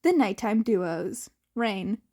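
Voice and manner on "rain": ordinary delivery, nothing marked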